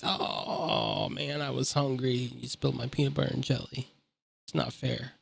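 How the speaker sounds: soft, whining complaint about his lost lunch; whiny hungry guard. Retold or imitated. Guard whines softly to Jin about spilled sandwich. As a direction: mope it out quietly like a sulky kid.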